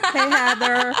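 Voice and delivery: high, slow Ohio River Valley greeting; Valley Girl-Southern accent. Retold or imitated. Woman mimics relatives greeting her, Ohio River Valley accent. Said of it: "L A Valley Girl and Southern".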